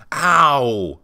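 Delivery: mock cry of pain. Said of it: "they'd be saying something like".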